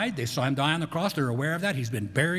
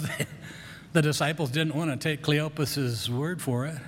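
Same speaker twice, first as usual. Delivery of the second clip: ordinary delivery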